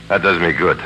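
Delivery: ordinary delivery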